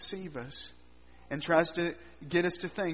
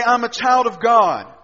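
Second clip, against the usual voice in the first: bold declaration; 2 semitones higher, 12 dB louder than usual. Acted. Believer answers Satan boldly.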